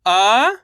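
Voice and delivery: "अ" held alone, rising; letter by letter. Shown as a demonstration. Teacher leads repeat-after-me, isolated rising "अ". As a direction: stretch "अ" for pupils to repeat. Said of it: "मेरे साथ साथ इनको repeat करना है".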